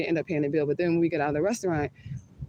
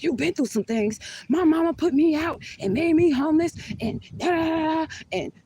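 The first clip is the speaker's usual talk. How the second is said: retelling the date yelling at her in a mocking high voice; 10 semitones higher than usual. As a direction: shout it up high like him losing it. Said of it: "he's yelling at me".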